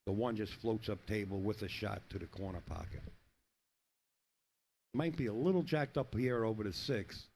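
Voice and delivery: ordinary delivery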